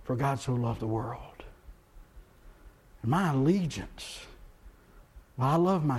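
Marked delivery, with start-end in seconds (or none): 0.1-1.4 softer, settled quote of the real verse; true verse. Shown as a demonstration. Pastor correcting the misquote, softer and sincere. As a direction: give the true words quietly, with conviction. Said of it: "John three sixteen says".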